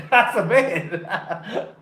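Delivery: slow, laughing through "That's a bet"; laughing repeat. Real, not acted. Repeats his own punchline to viewers, laughing. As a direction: savor the line while cracking up. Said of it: "Oh, that's a bet".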